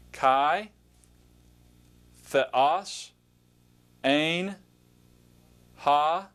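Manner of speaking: slow word-by-word Greek pronunciation, each word set apart; reading Greek aloud. Shown as a demonstration. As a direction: pronounce each Greek word clearly for learners to repeat. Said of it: "point to the word and just pronounce it".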